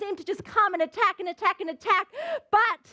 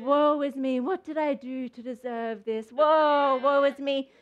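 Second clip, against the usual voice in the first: low, mournful mock lament; hammed-up woeful groaning; self-pity; 3 semitones lower, slower than usual. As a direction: moan it, wallowing in pity. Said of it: "start getting this self-pity of".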